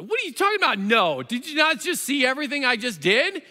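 high, disbelieving outburst; can't believe what he's hearing; incredulous Moses. Retold or imitated. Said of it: "He's like".

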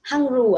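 "Hungry" ending in an oo sound, "hung-roo"; misread with U. Shown as a demonstration. Teacher questioning child's U spelling, "Hungry" mispronounced as hung-roo. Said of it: "Nếu mà con biết là chữ U thì cô sẽ đọc là".

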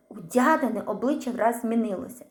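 plain reading voice

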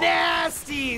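high, loud exclamation; hyped shout. Acted. Reading chat praise of Tom's survival, hyped shout.

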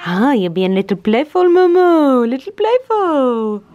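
high, loud playful cooing; squeaky praising a dog. Real, not acted. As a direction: coo at the playful puppy.

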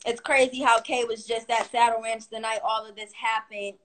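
ordinary talk, nothing marked

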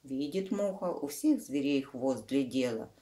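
ordinary delivery, nothing marked

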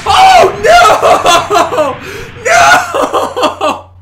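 high laughing scream. Real, not acted. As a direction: shriek in disbelief. Another death in game.

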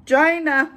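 high, loud mimicked CHINA, like "JY-nah"; CHINA as a loud, exaggerated "Jyna!"; imitated pronunciation. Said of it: "I love the way he says it".

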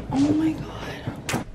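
soft, slow, stunned oh my god; awed. Real, not acted. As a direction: breathe it out in awe. Awed at the room.

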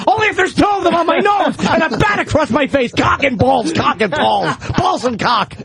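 hammed-up obsessive chanting; manic mock shout. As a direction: start in a high yell, easing as it repeats. Acted obsessed reply to baseball invitation.

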